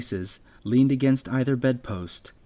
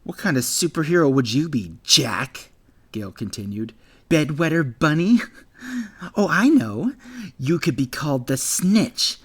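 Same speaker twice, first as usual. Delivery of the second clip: loud, higher taunting voice; jeering bully; 5 semitones higher, 6 dB louder than usual. Acted. Bully Gale mocking Jack's superhero dream, loud jeering. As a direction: toss out insulting names with glee.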